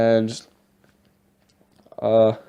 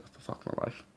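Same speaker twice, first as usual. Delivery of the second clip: exasperated whisper; 16 dB quieter than usual. Retold or imitated. Retold frustration after "I was like.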